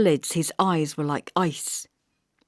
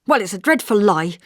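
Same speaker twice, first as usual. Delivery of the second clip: louder, indignant denial; angry; 5 semitones higher, 7 dB louder than usual. A narrator's reading. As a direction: hurl the accusation back, angrily. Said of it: "I told him angrily".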